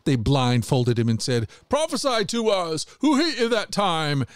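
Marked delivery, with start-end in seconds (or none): 1.7-4.2 much higher jeering; mocking. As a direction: goad the blindfolded prisoner. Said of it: "mocking and beating him".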